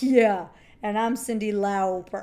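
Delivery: slow, loud mock agreement; sarcastic disbelief. Boy scoffing at her claim to be a girl, sarcastic. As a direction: drag it out with scornful sarcasm.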